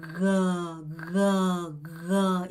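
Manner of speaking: low, drawn-out 그, not a Korean K; slow, level 그 for learners; demonstrated G sound. Shown as a demonstration. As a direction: hold 그 long and low with a voiced throat G. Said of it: "이렇게 발음하셔야 돼요".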